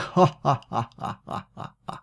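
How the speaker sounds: lautes, fieses Opa-Gelächter; dreckiges Lachen. A narrator's reading. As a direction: lache wie ein Bösewicht. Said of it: "Opa lachte". (Opa lacht dreckig und laut)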